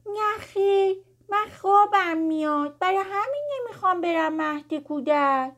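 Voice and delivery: high, whiny, pouting protest; upset little girl. A narrator's reading. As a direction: sulk in a high small-child voice. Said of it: "با ناراحتی ابروهاشو در هم کشید". (دختربچهٔ ناراحت و لجباز)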